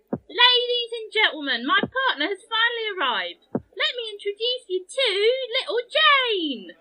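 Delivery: high, drawn-out, loud introduction; show announcer. Acted. Jester introducing her partner to an audience, showy announcing. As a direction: announce the act grandly, stretching it out.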